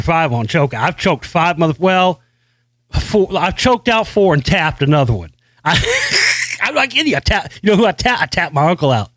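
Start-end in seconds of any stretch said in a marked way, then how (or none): 5.8-6.6 high laugh. Real, not acted. Laughs while bragging.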